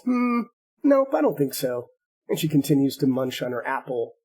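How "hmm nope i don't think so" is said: put-on higher, teasing little girl voice; cheeky girl. Girl in the tree teasing the centaur, higher and cheeky. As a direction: taunt her sweetly from the tree. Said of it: "The girl looks down at her and says".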